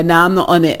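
normal talking voice

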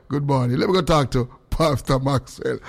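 ordinary talk

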